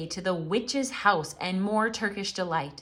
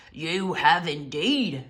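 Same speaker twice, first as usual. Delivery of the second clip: slow, loud beaver voice; Mister Beaver; 3 semitones lower, 5 dB louder, slower than usual. A narrator's reading. Mister Beaver agreeing with his wife, slow and weighty. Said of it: "said Mister Beaver".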